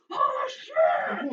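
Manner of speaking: loud shocked crowd yell; crowd shout. Acted. Crowd reacting in the arena.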